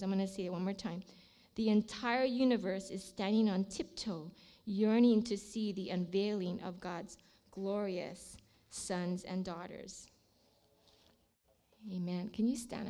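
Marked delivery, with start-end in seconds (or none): 1.6-10.1 slow, hushed scripture reading. Acted. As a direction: let the last words sink softly. Repeats the verse slowly.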